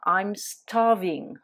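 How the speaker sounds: slow and careful, each word clear; slow model phrase. Shown as a demonstration. Teacher modelling the phrase slowly for French students. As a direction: spell it out slowly for the learners. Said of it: "pour exagérer, dire".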